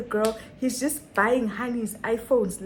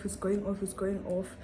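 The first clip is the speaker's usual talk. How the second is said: ordinary delivery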